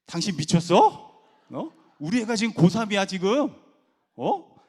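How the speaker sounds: loud, high-pitched scolding; outraged wife. Retold or imitated. Wife scolding husband who wants to quit, outraged shout. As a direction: berate him in acted alarm.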